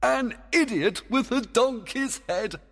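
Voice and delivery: high, gleeful praise; fairy king hugely pleased, pitched high; delighted. Acted. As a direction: laugh and crow over a splendid joke. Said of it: "cried Oberon with pleasure".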